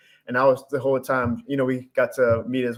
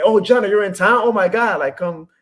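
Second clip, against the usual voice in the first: 1 excited fan invite; 7 semitones higher, 6 dB louder than usual. Retold or imitated. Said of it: "people randomly in New Mexico saying".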